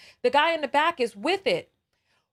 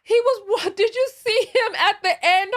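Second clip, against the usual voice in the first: laughing; 7 semitones higher, 5 dB louder than usual. Real, not acted. Host laughing at clip.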